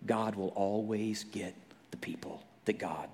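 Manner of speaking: softly whispered, intimate. Real, not acted. Preacher whispering God's purpose to listeners. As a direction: let it fall to a whisper.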